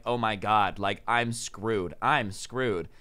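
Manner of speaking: quietly panicking host realising he's screwed; mild despair. Retold or imitated. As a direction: mumble it like doom sinking in. [name] realising he's in trouble.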